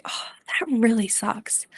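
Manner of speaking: soft, slow, whispered sympathy; sincere empathy. Shown as a demonstration. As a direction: hush down to comfort a hurting teen. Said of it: "we can offer some sincere empathy, like".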